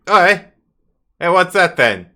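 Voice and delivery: loud, high Cockney guard calling out; startled Cockney hail from the alcove; Cockney accent. Acted. Guard spotting intruders, calls out in Cockney. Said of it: "They almost sound Cockney".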